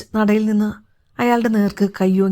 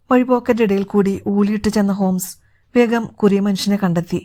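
ordinary delivery, nothing marked

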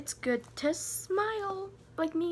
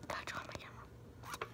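whispered aside; 14 dB quieter than usual. Real, not acted. Joke aside to herself.

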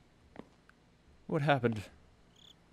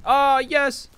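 loud high cheer of relief; triumphant; 11 semitones higher, 11 dB louder than usual. Real, not acted. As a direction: celebrate the find. Finds a page.

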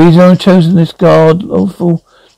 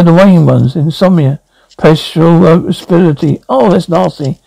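usual speaking voice

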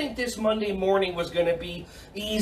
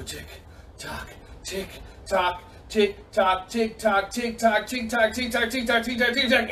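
ticking clock. Pretend. Coach leading kids as clocks, accelerating ticking.

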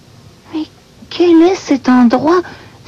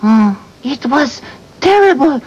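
put-on French-Canadian accent. Bird telling friends about the storm, Quebec accent. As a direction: storm tale in dramatic Québécois English. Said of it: "Because she's from Quebec".

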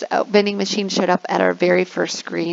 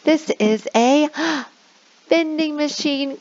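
mock gasp reveal; 7 semitones higher than usual. Shown as a demonstration. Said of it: "click very quickly".

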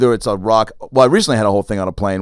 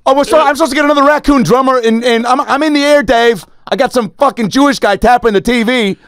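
higher, louder panicked roadie voice; much higher harried put-on voice; frazzled roadie; 13 semitones higher, 4 dB louder than usual. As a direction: play a stressed roadie on the phone. Imagined roadie on the phone.

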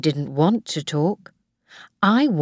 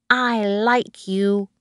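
put-on slow, higher small boy's voice; Tim; 4 semitones higher, slower than usual. A narrator's reading. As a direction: tell her affectionately, like a little child. Small boy's affectionate good night.

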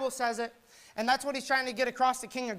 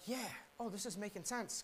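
softer, dawning conviction; hushed realization; 12 dB quieter than usual. Retold or imitated. Preacher voicing a convicted listener, hushed. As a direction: let it sink in quietly.

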